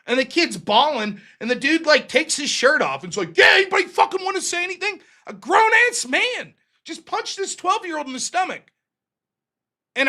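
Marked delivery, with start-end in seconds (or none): 3.4-5.0 high, fast challenge yelled at the crowd; a shirtless bully daring anyone to speak; taunting shout. Retold or imitated. Bully shouting a dare at the kids, high and fast. Grown man taunting after punching kid.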